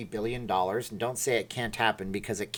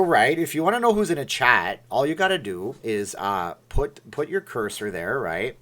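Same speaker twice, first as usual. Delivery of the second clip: normal talking voice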